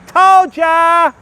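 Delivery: a high mocking told-you-so called out in passing; gloating taunt. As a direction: rub it in as you drive past. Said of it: "and I go past going".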